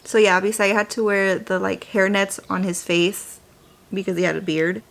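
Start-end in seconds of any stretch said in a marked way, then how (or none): none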